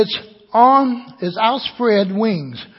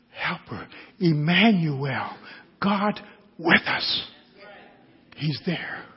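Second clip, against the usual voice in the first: hushed preaching; 4 semitones lower, 10 dB quieter than usual. Real, not acted. Preacher reassuring congregation that God is there, hushed. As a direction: let each phrase fall into silence.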